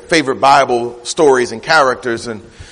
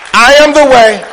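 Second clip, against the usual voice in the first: "I am the way" loud and raised; emphatic declaration; 11 semitones higher, 8 dB louder than usual. Retold or imitated. Preacher repeating Jesus's claim to the church, "I am the way" said emphatically. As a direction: hammer out "I am the way" as absolute.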